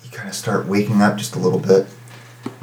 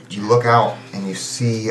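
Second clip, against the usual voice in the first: ordinary talk, nothing marked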